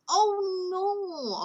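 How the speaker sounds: high, swooping dismayed oh no; dramatic oh no. Shown as a demonstration. Teacher showing intonation variety to trainees. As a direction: swoop the pitch down in mock dismay.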